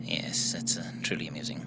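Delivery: breathy whisper from a low-voiced puppet; dummy whispering a weary yes. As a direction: hush it out, a mousy dummy. Dummy Maxie whispers dryly.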